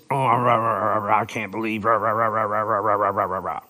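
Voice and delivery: low, muttered rah rah rah of a complainer; low grumbling complainer voice; mock grumbler. Shown as a demonstration. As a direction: grumble like a sulky critic. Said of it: "That's the idea behind the word".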